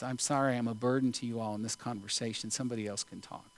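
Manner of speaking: teary apology. Retold or imitated. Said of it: "she got kind of teary-eyed".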